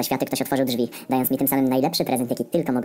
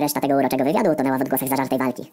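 usual speaking voice

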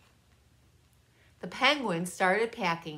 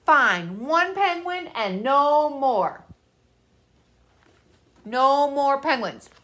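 louder, slow stern bear; gruff bear; 6 semitones higher, 6 dB louder, slower than usual. A narrator's reading. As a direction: lay down the law slowly. Parker grudgingly allows one.

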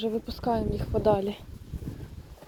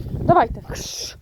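normal talking voice

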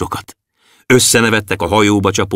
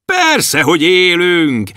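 high, ringing voices of the stars; stars calling back; 8 semitones higher, slower than usual. Acted. Stars shouting back to the dwarf, high. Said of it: "kiabálták vissza a csillagok".

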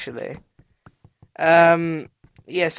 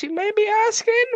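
quick, squeaky-high aside; high-pitched asking; faster than usual. Pretend. Player voicing the viewers' question, high and quick. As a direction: pitch it way up and rush it.

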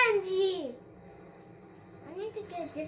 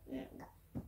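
soft breathy whisper of dread; whispered oh my god; 16 dB quieter, faster than usual. Real, not acted. Scared gamer whispers in tense moment.

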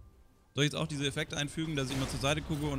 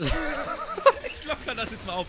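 laughing; 9 semitones higher, 4 dB louder, much slower than usual. Real, not acted. Laughing while joking.

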